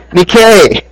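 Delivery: victory cry. Retold or imitated. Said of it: "he said".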